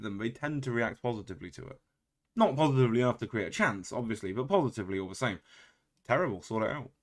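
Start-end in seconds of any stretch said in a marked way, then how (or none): 6.1-6.9 mock calm scolding of the team; understated dressing-down; calm telling-off. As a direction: scold them without raising your voice. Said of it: "I said that calmly".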